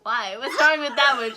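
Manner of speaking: retold outrage, loud on the second line; indignant protest.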